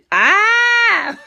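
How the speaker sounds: loud, high "ah!"; long high mock scream; playful mock scream. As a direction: let out a sudden high scream in fun. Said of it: "What you screaming for".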